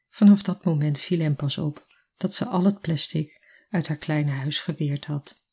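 ordinary delivery, nothing marked

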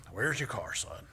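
lightly voiced dad, dropping low on "son"; a hint of a puzzled father; father impression. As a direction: imitate your father asking. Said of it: "he really does talk like that".

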